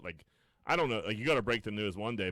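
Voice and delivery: normal talking voice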